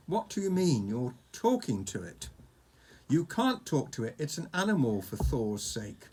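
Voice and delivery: ordinary delivery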